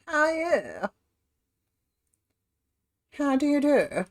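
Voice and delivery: hammed-up posh English greetings. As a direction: put on a plummy posh voice. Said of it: "but we'll be posh for a moment".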